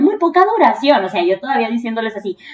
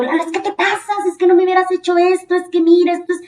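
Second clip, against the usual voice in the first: high, pleading angry protest; a retold, higher-pitched angry reproach; upset complaint; 7 semitones higher, 3 dB louder than usual. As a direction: scold a coworker who betrayed you. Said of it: "Salgo del estudio bien enojada".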